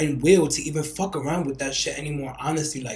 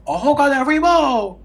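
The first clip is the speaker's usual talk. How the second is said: the "bowl" thrown up higher and louder; high amazed repeat; 9 semitones higher, 6 dB louder than usual. Retold or imitated. Retelling free food, amazed repeat. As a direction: say "A whole goddamn free bowl" again, higher, in delight. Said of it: "I said".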